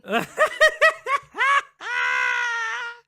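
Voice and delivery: a high, loud shriek of laughter that nearly tips into a cry. Real, not acted. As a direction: burst out in a screaming laugh. Laughing at crude chat remark.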